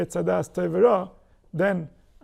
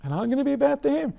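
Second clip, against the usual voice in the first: higher, quicker voice planning payback; a grievance building into a quick vow of revenge; resentful thought; 6 semitones higher than usual. Pretend. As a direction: voice a petty grudge, speeding up at the end. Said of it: "And he thinks".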